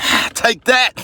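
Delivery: high, loud squealing taunt with a laugh; gleeful taunt. Retold or imitated. Mocking a backstabber, squealing high and loud. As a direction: shriek it like you just landed a hit. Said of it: "I'm like".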